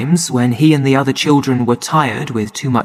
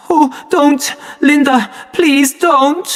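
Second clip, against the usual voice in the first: pleading; 12 semitones higher, slower than usual. A narrator's reading. Boy begs not to be hit.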